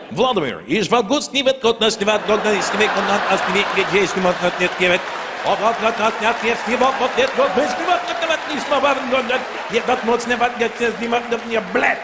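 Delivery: high, rapid fake Russian babble; mock Russian gibberish. Comic mimicking how Russian sounds to him. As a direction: rattle off nonsense like Russian. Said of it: "talking backwards the whole time".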